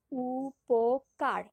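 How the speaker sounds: উপকার drawn out very slowly, each syllable held; stretched syllables. Shown as a demonstration. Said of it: "এখানে কিভাবে উচ্চারণ করছি?". (অক্ষর ধরে টেনে টেনে বলা)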